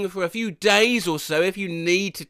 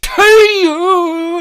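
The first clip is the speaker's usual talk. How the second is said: sudden high shouted yelp; 11 semitones higher, 8 dB louder than usual. Acted. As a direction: let out a high cry. Sudden outburst mid reading.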